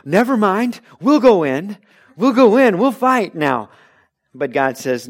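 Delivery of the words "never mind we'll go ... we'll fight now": high, loud and urgent change of heart; hammed-up frightened people blurting that they'll fight after all; loud, hasty mock eagerness; panicked backpedal. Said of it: "they said".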